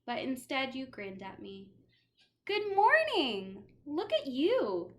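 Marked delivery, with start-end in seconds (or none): 2.4-4.9 bright, high and slow; cheery greeting. Acted. Teacher greeting a dripping pupil, bright and slow. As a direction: greet the soggy child with delight.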